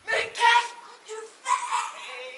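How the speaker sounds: high, drawn-out panicky shriek; alarmed cry. Acted. Wife sees husband going to the door, alarmed shriek. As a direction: stretch it into a high cry to stop him.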